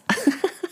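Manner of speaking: short high giggle; a quick real high laugh. Real, not acted. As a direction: giggle at your own punchline. Laughing at her own joke.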